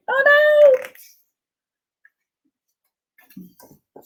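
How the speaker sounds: very high squeal of dismay; tiny cartoon voice crying oh no; squeaky oh no. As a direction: squeak it like a panicked little character.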